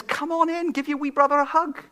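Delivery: coaxing father. Retold or imitated. Father voiced pleading.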